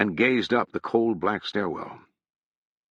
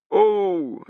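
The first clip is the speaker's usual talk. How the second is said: answering call; 12 semitones higher, 5 dB louder than usual. Acted. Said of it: "said a voice from the arches".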